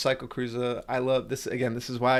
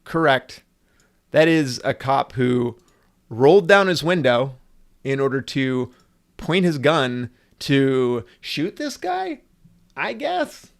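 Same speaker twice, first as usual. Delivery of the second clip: worked-up reaction; 8 dB louder, slower than usual. Real, not acted. Excited reaction to the clip.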